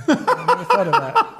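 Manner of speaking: high laughing line. Real, not acted. Guest laughing at the host's tease, high laughing line. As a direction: laugh it out, caught off guard.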